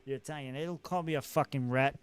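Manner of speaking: fast, loud, scornful Boston gangster; tough mobster. Acted. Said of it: "So that could be an angle to play".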